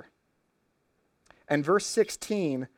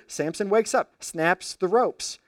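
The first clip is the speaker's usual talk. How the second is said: ordinary talk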